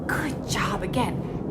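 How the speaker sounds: soft unvoiced good job again; whispered praise. Real, not acted. Teacher praising the child in a whisper. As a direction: breathe the praise out softly.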